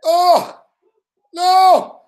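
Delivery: high, loud gasp of dismay; real alarm at the play; shocked oh no. Real, not acted. Streamer reacting to the game, high shocked cry.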